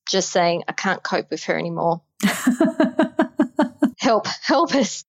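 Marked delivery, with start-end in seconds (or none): none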